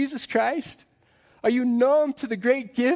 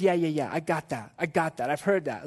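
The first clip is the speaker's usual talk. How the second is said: lightly mocked dismissiveness, a bit lower; prideful brush-off; 4 semitones lower than usual. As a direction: wave it away like a know-it-all. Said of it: "this guy is so full of pride".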